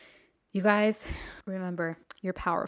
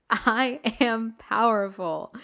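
slow, louder affirmation chant; booming motivational speech; 3 semitones higher, 7 dB louder, slower than usual. Shown as a demonstration. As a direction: lead the crowd in a proud affirmation. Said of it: "Say it with me".

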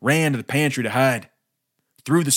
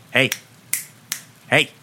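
snapped. A narrator's reading. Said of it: "he snapped in the shopkeep's face".

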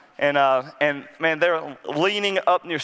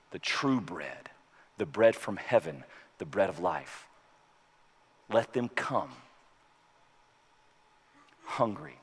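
pastoral prayer; 3 semitones lower than usual. Acted. A pastor praying over communion, earnest and hushed. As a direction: pray over the congregation.

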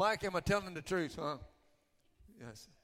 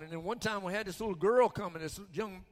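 ordinary talk, nothing marked

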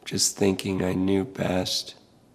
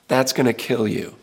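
ordinary talk, nothing marked

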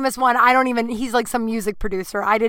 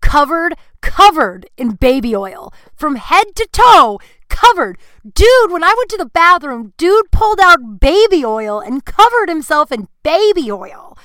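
incredulous; 7 semitones higher, 11 dB louder than usual. Real, not acted. Incredulous retelling of baby oil.

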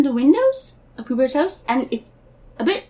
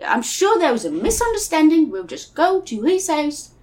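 put-on high British boy turning cockney; cockney Christopher Robin; 3 semitones higher than usual. Christopher Robin calms Piglet in a drifting cockney accent. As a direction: reassure in a hammed-up cockney accent. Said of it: "I'm getting more and more cockney".

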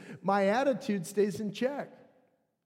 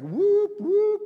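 high, loud rising siren whoops; vocal siren, swooping upward; imitated alarm whoop; 12 semitones higher, 7 dB louder than usual. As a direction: whoop like a body-wide alert going off. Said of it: "the whole body goes into full alert".

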